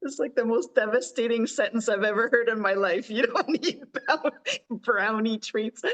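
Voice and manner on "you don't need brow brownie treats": laughing through the words, slow; laughing quote. Retold or imitated. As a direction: repeat it while cracking up. Quoting a sentence, amused.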